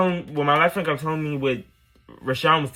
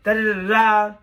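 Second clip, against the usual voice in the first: mocking the ex; 7 semitones higher than usual. Ex told her friend off, lightly mocking higher voice. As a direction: imitate him as petty and preachy. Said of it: "He was like".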